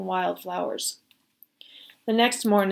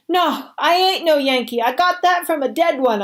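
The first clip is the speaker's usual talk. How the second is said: higher, louder, frightened rush of denial; sputtering denial; 7 semitones higher, 5 dB louder than usual. Acted. Boy sputtering a denial to the soldiers, higher and louder. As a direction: sputter it out to convince him. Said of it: "I sputtered".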